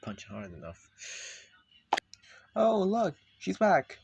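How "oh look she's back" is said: high, very loud exclamation; loud announcement. Acted. Speaker greets the returning character, loud high exclamation.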